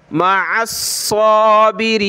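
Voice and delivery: मा अस साबिरीन with a thick, heavy स; heavy saad. Shown as a demonstration. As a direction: recite साबिरीन with a full-mouthed emphatic S. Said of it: "मोटा करेंगे सौद को".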